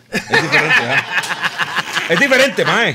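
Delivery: 1 laughing mock shout. Hamming a joke to the hosts.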